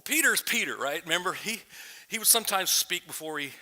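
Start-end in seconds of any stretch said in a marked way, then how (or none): none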